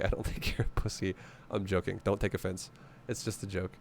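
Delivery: hushed, breathy laughing take-back; whispered aside. Real, not acted. Streamer reassuring offended viewers in a hushed whisper. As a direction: drop to a near whisper to soften the joke.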